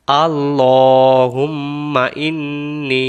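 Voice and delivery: আল্লাহুম্মা drawn out, ইন্নী with a long ই; slow model recitation. Shown as a demonstration. Teacher showing students how আল্লাহুম্মা ইন্নী is recited, slowly. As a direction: stretch আল্লাহুম্মা and hold the final ই of ইন্নী. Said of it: "এই জায়গার প্রথম শব্দটা আমরা বলবো".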